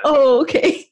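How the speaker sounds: high, dawning realisation; thinking out loud. Acted. Listener thinking out loud as she catches on, dawning realisation. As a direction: lift the pitch as it clicks. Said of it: "just me thinking out loud".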